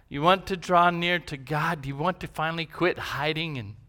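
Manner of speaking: ordinary talk, nothing marked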